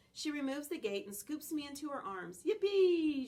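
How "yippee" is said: excited. Acted. Dog scooped up by Hattie.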